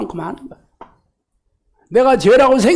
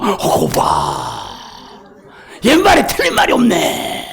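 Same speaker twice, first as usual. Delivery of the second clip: smug told-you-so; 8 semitones lower, slower than usual. Retold or imitated. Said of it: "이러고 확신을 해요".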